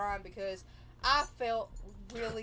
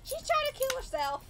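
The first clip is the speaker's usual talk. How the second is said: very high, frantic announcement; imitating mother's outcry; 3 dB louder than usual. Mother bursting into grandmother's house, frantic imitation. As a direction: wail it high, mimicking a panicked parent. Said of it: "That actually kinda sounded like her".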